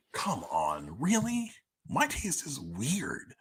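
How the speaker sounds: sharp high disbelieving "really?"; incredulous. Real, not acted. To listeners mocking vague feedback, incredulous. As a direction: pitch jumps up in disbelief. Incredulous at "liked the whole thing.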